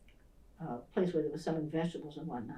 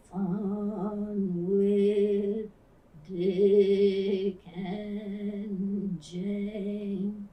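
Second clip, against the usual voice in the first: very slow, louder, word by word; halting first reading; 2 semitones higher, 5 dB louder, much slower than usual. Retold or imitated. Boy struggling to read his first book aloud, very slow and halting. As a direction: sound out each word like a beginner.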